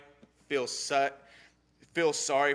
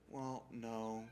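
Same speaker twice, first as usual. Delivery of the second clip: small, much softer sulky answer; child's reply; 3 semitones lower, 14 dB quieter than usual. Retold or imitated. Daughter answering her parent, softly sheepish. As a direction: mumble it like a calmed child. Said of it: "I'm like".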